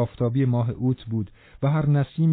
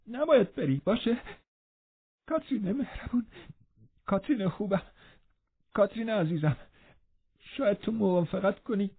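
sobbing; 9 semitones higher, 6 dB quieter than usual. A narrator's reading. Linton on the ground begging Catherine, sobbing. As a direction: beg through sobs, barely voiced. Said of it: "با حق حق گفت".

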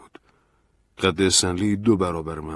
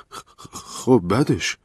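stammering; 5 semitones higher, 5 dB louder, much slower than usual. A narrator's reading. Narrator stammers in shock.

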